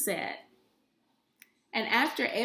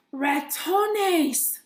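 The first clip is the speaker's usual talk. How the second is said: higher, louder alarmed cry; 6 semitones higher, 5 dB louder than usual. Acted. Grandmother finds signs of mice, higher, louder cry. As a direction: shriek at the mice. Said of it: "she cried".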